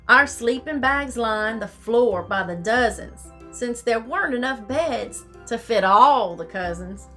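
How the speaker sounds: ordinary delivery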